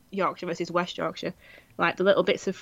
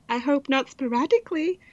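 quoting Clueless; 5 semitones higher than usual. Retold or imitated. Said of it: "in Clueless where she's like".

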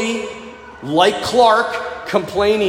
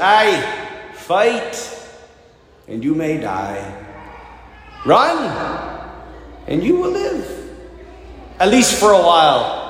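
Wallace's Scottish battle speech, building to a shout; Scottish accent; 2 semitones higher than usual. Retold or imitated. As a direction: rouse the troops in a Scottish accent. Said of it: "use my best Scottish accent".

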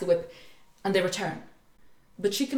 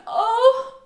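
much higher, louder, slow hammed-up wail; mock-tragic high wail, laughing at the end; complaining cat princess; 9 dB louder, slower than usual. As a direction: moan it like a melodramatic princess, breaking into a laugh at the end. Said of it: "she starts to complain".

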